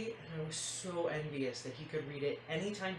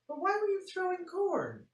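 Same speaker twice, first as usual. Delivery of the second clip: mom in disbelief; 5 dB louder than usual. Acted. Mom questions daughter, rising disbelief.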